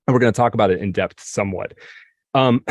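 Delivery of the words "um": ordinary talk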